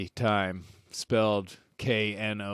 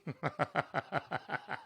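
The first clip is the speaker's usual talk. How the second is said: laugh; 3 semitones higher, 12 dB quieter than usual. Real, not acted. Laughing after his impression.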